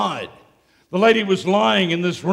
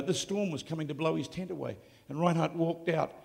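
normal talking voice

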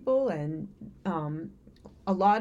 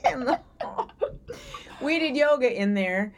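laughing; 4 semitones higher than usual. Real, not acted. Laughing while talking.

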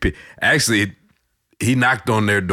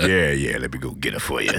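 deep voice reply; 7 semitones lower, 3 dB quieter than usual. Retold or imitated. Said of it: "I was like".

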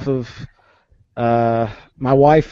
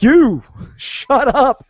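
slow, much higher and louder mock yell with a laugh breaking in; retold yell; 11 semitones higher, 6 dB louder, much slower than usual. Scott yelling at Jake to shut up before the reveal, laughing mock yell. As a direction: point and bellow, half laughing.

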